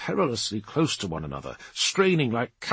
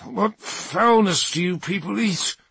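disgusted Dark Angel; 8 semitones higher than usual. Acted. Ormond disgusted after herbs.

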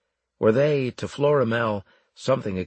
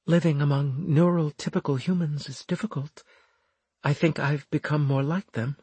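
high, husky woman's character voice; pitched-up, subdued confession; vulnerable Florimel, confiding; 6 semitones higher, faster than usual. A narrator's reading. Voices Florimel on Earth life.

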